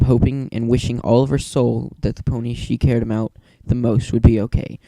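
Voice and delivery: usual speaking voice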